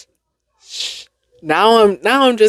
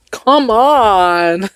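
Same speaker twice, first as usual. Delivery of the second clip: drawn-out, higher exasperated plea; 5 semitones higher, 3 dB louder than usual. Real, not acted. Woman fumbling a tongue twister, exasperated. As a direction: groan it out at your own failure.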